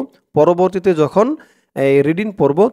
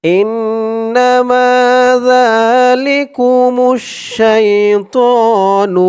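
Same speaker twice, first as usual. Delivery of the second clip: slow, higher sung recitation; 7 semitones higher, 4 dB louder, much slower than usual. Shown as a demonstration. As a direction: chant it like a qari. Melodic recitation.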